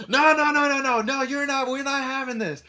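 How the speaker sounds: very high-pitched mock objection; imitated outraged refusal. Woman to the returning drunk, high mock-outraged refusal. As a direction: shut him down in a high voice.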